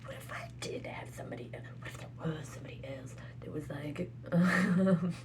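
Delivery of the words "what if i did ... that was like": unvoiced hushed musing; whisper. Real, not acted. Wondering aloud about someone else in a whisper. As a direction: breathe the question softly.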